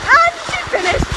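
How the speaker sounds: high, excited ski-race commentator; frantic sports commentary. Acted. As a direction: call it like a live race.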